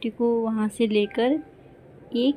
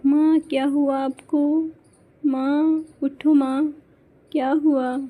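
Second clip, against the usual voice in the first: anxious, higher and louder calls to a fallen mother; frightened calling; 4 semitones higher, 3 dB louder than usual. Acted. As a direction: call out to her in alarm, slowly. Mother collapses, daughter calls out. (घबराई हुई पुकार)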